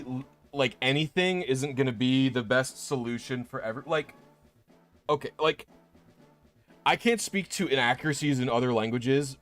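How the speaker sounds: ordinary talk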